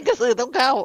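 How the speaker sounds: laughing. Real, not acted. Joking about ghost names.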